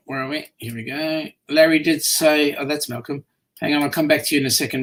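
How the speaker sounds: normal talking voice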